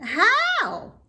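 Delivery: very high, loud single word; eager question. A narrator's reading. Wilbur eagerly asks Charlotte, very high. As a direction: jump on it eagerly.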